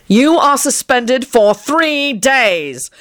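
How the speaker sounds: slow, high-pitched angry decree; thundering. A narrator's reading. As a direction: thunder each word out slowly. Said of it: "Kingsley thundered".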